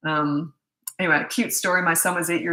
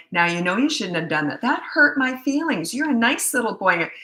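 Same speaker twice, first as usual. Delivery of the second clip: mini therapy parent; 4 semitones higher than usual. Retold or imitated. Parents holding mini therapy sessions with their toddler, mock-sweet lecturing. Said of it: "these mini therapy sessions with their toddler".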